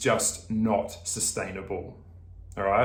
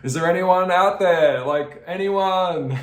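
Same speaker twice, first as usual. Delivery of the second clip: mock lonely call; 7 semitones higher, 6 dB louder, slower than usual. Lonely person calling into void.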